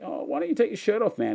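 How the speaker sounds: high, fast mock taunt from a fit guy. Mimicking the fit guys who ask him to go shirtless, high and quick. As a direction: toss it off breezily like a smug gym guy. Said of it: "then they're like".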